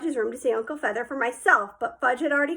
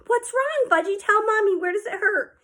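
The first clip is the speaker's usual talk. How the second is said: soothing mother; 9 semitones higher than usual. A narrator's reading. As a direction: coo to a crying toddler. Mother comforting crying child.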